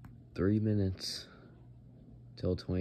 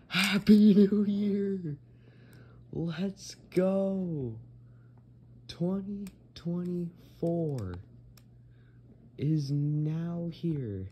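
much higher, louder, slow jubilant shout; new year countdown crowd; 10 semitones higher, 6 dB louder, much slower than usual. Real, not acted. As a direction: cheer it out at midnight. Midnight strikes, celebrating.

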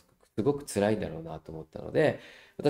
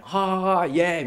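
mock cheering laugh; 8 semitones higher, 4 dB louder than usual.

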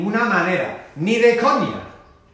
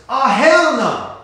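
loud, slow, high refusal, hell stretched; slow emphatic hell no; 7 semitones higher, 7 dB louder, slower than usual. Shown as a demonstration. Teacher repeating the slang refusal, loud and slow. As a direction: drag it out loud. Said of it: "muy común en Estados Unidos".

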